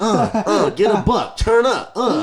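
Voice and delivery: high, loud mock rapper freestyle; doing a silly freestyle voice, laughing through it; imitating a hyped rapper. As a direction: ham up a clumsy kid rapping over a beat. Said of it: "He'll be like".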